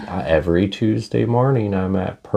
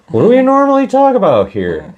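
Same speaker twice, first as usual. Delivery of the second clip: much higher, fast squeak; squeaky voice; 6 dB louder, faster than usual. Real, not acted. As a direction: squeak it out quickly. Squeaky rhetorical question.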